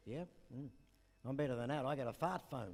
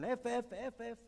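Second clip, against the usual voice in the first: higher, slow sailor's voice calling down; officer calling down to his wife on the wharf; officer calling back; 9 semitones higher, slower than usual. Retold or imitated. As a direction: call back slowly from the bridge. Officer answering.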